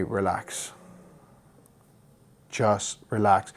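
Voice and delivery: soft, slow reassuring voice; calming. Retold or imitated. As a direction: talk a panicking man down gently. Calming drowning man.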